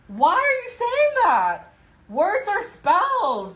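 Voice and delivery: high, drawn-out disbelieving reproach; exasperated scolding. Acted. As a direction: plead with them, stretching every word. Scolds listeners for negative self-talk.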